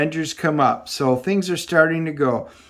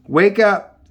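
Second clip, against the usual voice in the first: louder, higher call to rouse a sleeper; urgent wake-up call; 6 semitones higher, 5 dB louder than usual. Acted. She rouses the sleeping Hercules, loud and urgent. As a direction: shout him awake.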